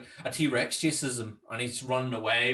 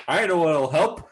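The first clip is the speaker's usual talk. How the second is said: loud, higher Homer quote; mock exclamation; 5 semitones higher, 8 dB louder than usual. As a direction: blurt out a bright idea, hammed-up. Acts out Homer fleeing from dogs.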